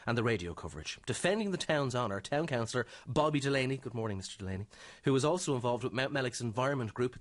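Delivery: ordinary talk, nothing marked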